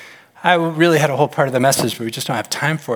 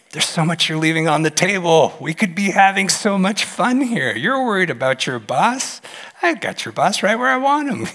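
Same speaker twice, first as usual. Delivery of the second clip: higher, animated, eager coaxing; exuberant God voice; 8 semitones higher than usual. Acted. God cheering on a worried believer, exuberant and high. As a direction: excitedly urge them to join the fun. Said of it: "I think he just gets, like".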